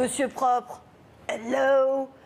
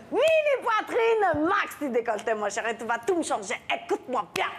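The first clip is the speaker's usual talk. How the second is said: high, bossy makeover diva; Christina's voice; 5 semitones higher than usual. Pretend. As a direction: order her about like a fashion guru. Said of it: "par contre, Christina, en fait".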